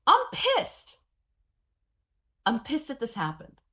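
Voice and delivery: high, loud angry scream, easing at the end; furious yell. Retold or imitated. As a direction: let the rage burst out, then come down. Anger released on stage.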